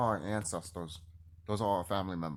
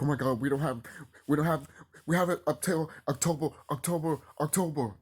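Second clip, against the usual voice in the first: higher, louder imitation of people panicking; mock panic; 7 semitones higher, 5 dB louder than usual. Vlogger mimicking orcs panicking about October, mock panic. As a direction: babble it in mock alarm, repeating yourself. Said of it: "these orcs panicking".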